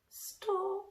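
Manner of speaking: hushed, timid little word; a shy child's soft answer; whispered softly. A narrator's reading. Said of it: "she whispered".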